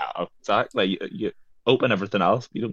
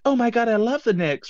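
high, gushing exclamation; high-pitched imitation of a thrilled fan; 12 semitones higher than usual. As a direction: act out an excited gush, pitched way up. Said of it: "she was like".

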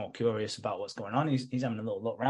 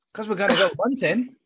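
higher, louder burst for the quote; hammed-up crazed hunter, voice jumping up; manic eagerness; 7 semitones higher, 6 dB louder than usual. Crazy son answers about the knife, manic eagerness. Acts out the crazy son's reply.

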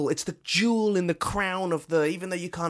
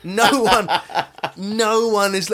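laughing; 6 semitones higher, 6 dB louder, slower than usual. Real, not acted. Laughing about Checo's fame.

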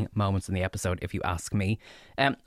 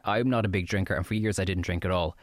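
ordinary talk

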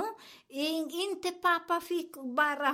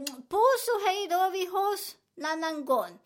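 higher, louder acted goodbye; breezy brush-off; 5 semitones higher, 5 dB louder than usual. Woman shrugging off people who scream at her, breezy brush-off. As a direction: toss off a carefree farewell, pitched up.

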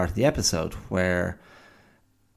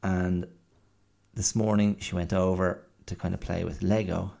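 ordinary talk

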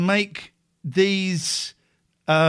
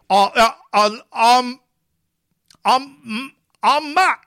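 higher and louder, stammering; imitating Matt; 6 semitones higher, 6 dB louder than usual. Host mimicking Matt introducing himself, mock stammer. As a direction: ham up a nervous contestant. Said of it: "I just wanna go".

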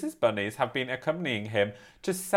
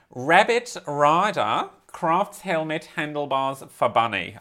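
slow, lightly Australian headline read; Australian accent attempt. Shown as a demonstration. Reading the headline to her co-host in an Australian accent. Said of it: "I can't read it in the whole Australian accent".

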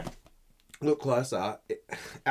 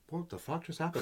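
small, high, hushed voice of a stunned mouse; bewildered swallowed mouse; 7 semitones higher, 10 dB quieter than usual. Acted. Live mouse talking from inside the chook's stomach, quietly bewildered. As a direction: squeak it softly, utterly baffled. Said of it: "in its stomach going".

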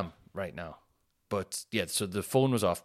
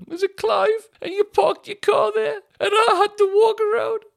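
squeaky, much higher and louder whine, close to crying; silly high whiny voice; 9 dB louder than usual. Pretend. Comic whine at a stranger who took his parking spot. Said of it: "I love doing that voice".

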